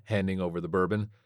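ordinary delivery

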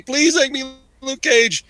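high, drawn-out imitation of a pleading fan; hammed-up plea; mock begging. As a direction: beg like an overeager fan, stretching it out. Retold fan begging for role.

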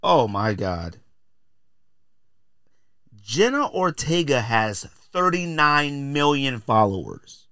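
ordinary talk, nothing marked

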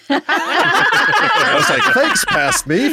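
laughing. Real, not acted. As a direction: thank your past self while cracking up. Laughing at his own edit.

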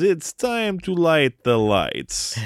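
put-on slow, high, evil Russian-accented villain; sinister slow Russian frog voice; evil, ominous Constantine. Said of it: "but in a really evil, ominous way".

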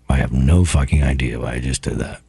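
ordinary talk

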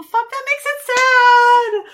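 loud wailing cry of despair; anguished cry. Real, not acted. Mock despair over sad theory.

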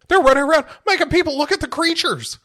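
very high, hammed-up hysteria; panicked pleading. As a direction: babble like a terrified fugitive. Playing panicked Gary pleading for shelter.

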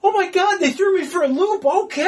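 high-pitched excited disbelief; thrilled outburst. Retold or imitated. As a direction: gush in mock shock, ending on a laugh. Retelling thrilled reaction to twist.

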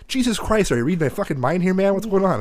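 high, fast disbelief; exasperated outburst. Retold or imitated. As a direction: act out frantic exasperation. Retold exasperation at dad blocking him.

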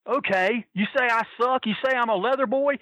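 high-pitched mock confrontation; worked up, but not shouted; retold angry challenge. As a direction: imitate a riled wrestler calling someone out. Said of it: "And he's screaming".

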